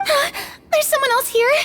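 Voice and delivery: gasping, alarmed question; startled gasp. Pretend. Player gasping at footsteps in the darkness. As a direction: gasp in fright at a presence. Frightened in the dark.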